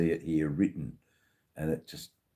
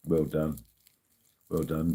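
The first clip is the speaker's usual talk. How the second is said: ordinary delivery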